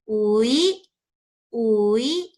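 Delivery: "ui, uida" demonstrated loudly as uuui-da; lengthened first vowel. Shown as a demonstration. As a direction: stretch the u in "uida", then add da. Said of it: "la voy a pronunciar más larga".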